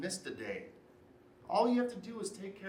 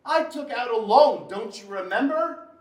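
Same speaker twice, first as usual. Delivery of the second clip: loud, forceful comeback; angry retort; 5 semitones higher, 10 dB louder than usual. Acted. Husband reminding wife of his loan, loud angry retort. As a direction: shout it back at her. Husband shouting back in quarrel.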